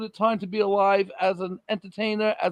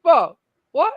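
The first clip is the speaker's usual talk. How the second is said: incredulous; 5 semitones higher, 7 dB louder than usual. Retold or imitated. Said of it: "I'm like".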